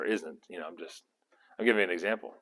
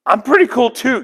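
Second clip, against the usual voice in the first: playful boast; 11 semitones higher, 9 dB louder than usual. Acted. God voiced bragging, acted.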